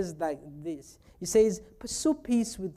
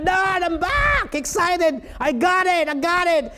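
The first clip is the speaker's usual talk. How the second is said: higher, eager shout of a son coming home; excited son; 8 semitones higher, 4 dB louder than usual. Retold or imitated. Son bursting in to tell his dad, eager mock shout. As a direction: call it out proudly, half-sung.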